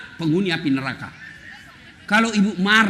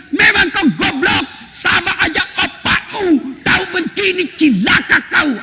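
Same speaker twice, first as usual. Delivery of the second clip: shouted, high mock tirade at the husband; istri memaki; 9 semitones higher than usual. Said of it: "Tapi yang tidak boleh maki-maki suami". (istri memaki suami dengan suara tinggi)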